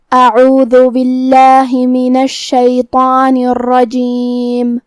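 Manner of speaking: slow melodic tajweed chant; measured Quranic recitation. Acted. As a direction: recite it slowly, drawing out each vowel.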